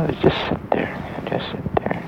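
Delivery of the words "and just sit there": plain reading voice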